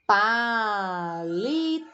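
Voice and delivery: palito drawn out slowly with stress on -li-; teacher slowly sounding out palito; slow stressed word. Shown as a demonstration. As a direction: stretch palito out, leaning on the last syllables. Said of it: "por exemplo".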